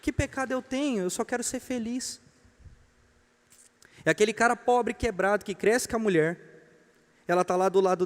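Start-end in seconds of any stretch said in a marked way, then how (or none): 0.0-2.2 high, soft mock-innocent plea; self-justifying cheater. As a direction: plead innocence in a small, raised voice. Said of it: "ela vai dizer".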